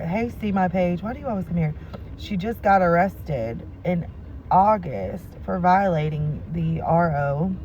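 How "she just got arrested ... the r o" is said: slow and emphatic. Real, not acted. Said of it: "are you listening to what I'm telling you?".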